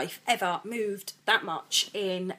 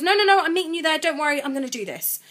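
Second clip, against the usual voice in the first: louder and higher, insisting; insistent reassurance; 8 semitones higher, 8 dB louder than usual. Retold or imitated. Darren insisting he'll meet her there, loud and firm. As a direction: brush her objection aside firmly. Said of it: "he goes".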